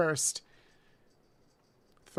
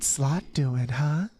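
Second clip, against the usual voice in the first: mommy voice; 6 semitones lower than usual. Pretend. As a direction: tease the chatter as a seductive mommy. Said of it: "I have to do mommy voice".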